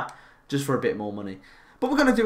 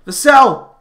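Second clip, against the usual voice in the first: goal celebration; 11 semitones higher, 10 dB louder, slower than usual. Real, not acted.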